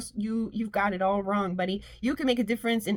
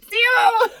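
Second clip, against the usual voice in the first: delighted cry; 12 semitones higher, 8 dB louder than usual. Real, not acted. Delighted shout.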